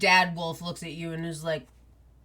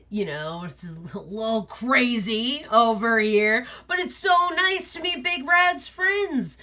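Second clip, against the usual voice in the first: wolf father welcoming his brother's friends; jolly wolf dad; 4 semitones higher than usual. Acted. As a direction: greet the guests big and friendly. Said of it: "is like".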